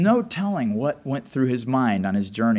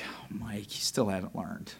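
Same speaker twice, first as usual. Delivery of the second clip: much softer, lower, patient chiding; gentle rebuke; 6 semitones lower, 10 dB quieter than usual. Retold or imitated. God gently chiding Mike, soft and low. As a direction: shake your head kindly. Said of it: "The Lord was like".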